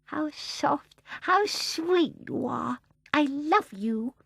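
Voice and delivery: high, slow, tender cooing; adoring baby. Acted. Baby Barbara to the sunlight, high adoring coo. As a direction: coo it slowly in a high little voice.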